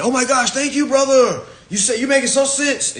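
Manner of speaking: high, loud gushing gratitude; gushing follower. Retold or imitated. Mocking a follower thanking a new guru, high and loud. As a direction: gush like a starstruck fan.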